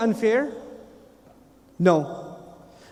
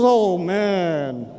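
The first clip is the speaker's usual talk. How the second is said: long, drawn-out groaning words; acted-out dismay for someone else; dismayed sympathy; 3 semitones lower than usual. Man behind sees the bad report, dismayed.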